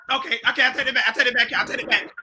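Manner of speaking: usual speaking voice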